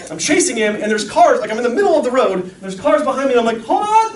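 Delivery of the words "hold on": high, loud mock cry; retold panicked call. Dog owner in the road with cars behind, mock panicked. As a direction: call out in comic alarm, far up in pitch.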